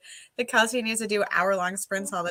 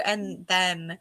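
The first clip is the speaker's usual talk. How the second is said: ordinary delivery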